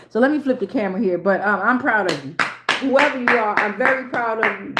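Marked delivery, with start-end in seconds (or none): none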